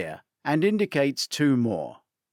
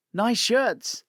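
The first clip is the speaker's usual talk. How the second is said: friendly lady; 9 semitones higher than usual. Acted. Lady from craft class compliments.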